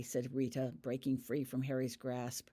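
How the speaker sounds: usual speaking voice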